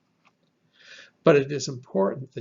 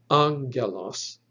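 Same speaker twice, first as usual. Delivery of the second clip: angelos with the stress on ANG: ANG-gel-os; ANG-gel-os, emphasis up front; first-syllable stress; 3 dB louder, slower than usual. Shown as a demonstration. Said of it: "emphasis should be on that first".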